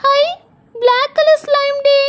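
put-on high, slow, drawn-out child's exclamation; thrilled little girl. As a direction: stretch the discovery in a high girlish voice. (வியக்கும் சின்னப் பொண்ணு குரல்)

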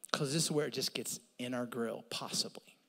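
soft unvoiced whisper; hushed confiding whisper. Real, not acted. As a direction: lean in and whisper it. Intimate sermon aside.

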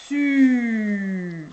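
"Su" loud, with a long held French U; insisted vowel sound. Shown as a demonstration. Teacher making the child hear a vowel, "Su" drawn out loud. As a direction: stretch "Su" and dwell on the U. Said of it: "tu dois entendre une voyelle".

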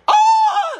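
very high, loud pained yelp; horrible yell. Retold or imitated. Cellmate yelling when her hair is pulled, high pained yelp. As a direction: shriek like you've been grabbed hard. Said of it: "she let out a horrible yell".

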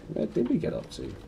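breathy whispered aside. Real, not acted. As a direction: drop to a whisper, half to yourself.

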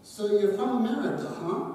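put-on a friendly old Scotsman's Scottish accent, only hinted; elderly Scotsman in the sauna, mild Scottish accent. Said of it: "In his Scottish accent".

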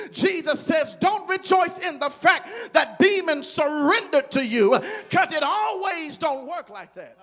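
loud, rising preacher's build; preaching at full force. Acted. Preacher promising the church great ministry moments, at full force.

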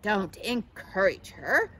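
slow, loud, scornful put-down; haughty caterpillar mockery; mocking. A narrator's reading. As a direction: lean hard on each word with scorn. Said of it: "mocked Katisha".

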